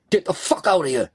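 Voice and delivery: high, urgent yell without much volume; screamed order. A narrator's reading. Robber screaming at the getaway driver, screamed order. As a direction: yell it as a frantic getaway command. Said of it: "Mickey screamed".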